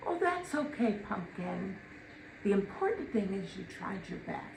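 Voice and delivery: soft, sweet sitcom mom voice; gentle TV mom. Retold or imitated. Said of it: "No, she didn't. That was a, a Carol Brady".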